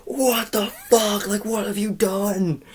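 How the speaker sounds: high, slow mock outrage; mock enraged. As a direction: squeal in fake shock. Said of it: "be like".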